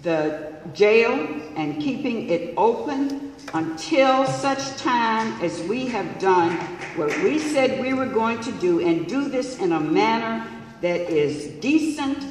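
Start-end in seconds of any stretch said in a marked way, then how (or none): none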